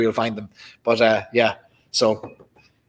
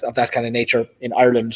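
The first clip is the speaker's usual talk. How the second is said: normal talking voice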